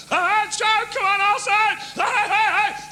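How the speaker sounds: very high, loud terrace yell; football shout. Acted. Little fella at the football match yelling at the players, loud high shout. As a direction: bellow at the pitch like a roaring fan.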